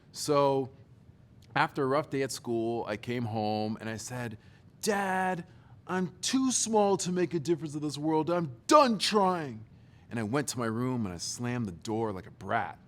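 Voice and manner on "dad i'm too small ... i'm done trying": put-on whiny teen. Teen to his dad after being bullied, whiny and sulky. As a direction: play the self-pitying fourteen-year-old.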